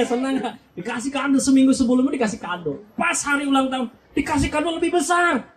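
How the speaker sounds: ordinary talk, nothing marked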